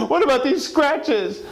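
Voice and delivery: high, slow wife voice; wife's accusing voice. Acted. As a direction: stretch it out high and pointed. Wife confronts husband.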